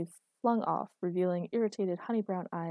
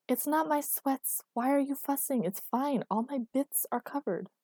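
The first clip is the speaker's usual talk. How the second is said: higher-pitched, indignant arguing; defensive protest; 7 semitones higher than usual. A narrator's reading. Girlfriend defends her clothes to fussing boyfriend, indignant. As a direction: argue back that it's all fine. She argues back about outfit.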